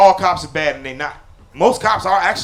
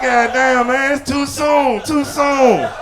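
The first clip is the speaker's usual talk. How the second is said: higher, dragged-out groan of too soon, rising to a laughing shout; mock-pained cousin objecting; cousin's protest; 5 semitones higher, much slower than usual. Cousin reacting to the grandma joke, mock-pained groan.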